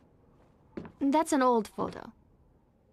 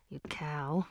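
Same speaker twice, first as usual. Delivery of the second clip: low, soft dig under the breath; quiet aside at an absent enemy; muttered insult; 6 semitones lower, 7 dB quieter than usual. Acted. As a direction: drop the voice and mutter it spitefully. Insult aimed at absent secretary.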